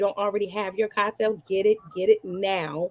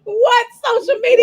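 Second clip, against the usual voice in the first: mock outrage; 13 semitones higher, 9 dB louder than usual. Mock shock at Instagram.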